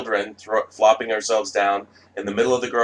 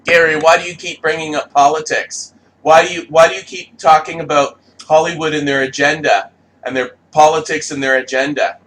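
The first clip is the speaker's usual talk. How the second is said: higher and louder, whiny mock repetition; mocking nagging questions; 6 semitones higher, 7 dB louder than usual. Mimicking critics badgering Gary, loud and whiny. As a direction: nag like an annoying critic.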